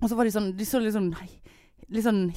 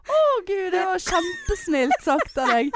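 much higher, slow gushing reply; flattered self; 10 semitones higher than usual. Retold or imitated. Herself thanking the fan, mock-flattered gush. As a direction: play yourself touched and gushing. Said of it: "og jeg bare".

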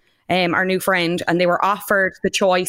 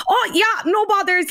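put-on high eager boyish voice; chirpy high young lad; imitating Jordan; 13 semitones higher than usual. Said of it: "Jordan's like".

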